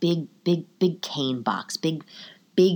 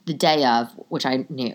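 ordinary talk, nothing marked

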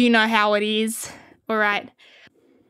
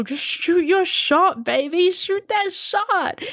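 1 hype encouragement; 8 semitones higher, slower than usual. Acted.

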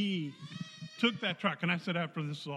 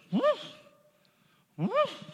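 high, rising "woof" whoosh; imitated truck skid; 5 dB louder than usual. Driver mimics his truck going sideways, high swooping noise. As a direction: whoop upward like a vehicle suddenly sliding. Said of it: "my truck goes".

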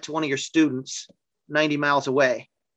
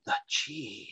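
lightly mocking the guru's low hushed tone; a hint of a mystic master, drawn out; stilted profound voice; 4 semitones lower, 12 dB quieter than usual. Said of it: "they talk with that stilted voice where they try to sound more profound".

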